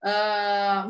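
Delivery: ordinary delivery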